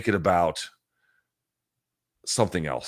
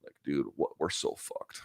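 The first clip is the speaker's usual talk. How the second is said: whispered; 4 semitones lower, 10 dB quieter than usual. Real, not acted. Despairing aside repeated.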